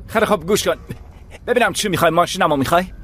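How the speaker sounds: buy them off in panic; frightened bargaining. Acted. Bargaining in fear. (معامله با ترس)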